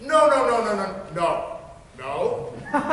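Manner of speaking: fading from shout to scoff; emphatic refusal. Acted. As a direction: slam the door on Hermia. Lysander rejecting Hermia.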